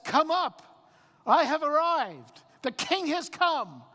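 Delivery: high, ringing proclamation; loud command. Acted. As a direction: call it out loud and slow, like a royal summons. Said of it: "what will be this loud command?".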